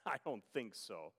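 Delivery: low, dismissive scoff after a chuckle; sarcastic scoff. Retold or imitated. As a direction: imitate a sceptic privately laughing it off. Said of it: "scoff and sarcastically say".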